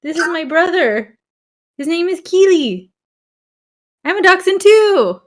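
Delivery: 1 playing the dog. Speaking as the dog.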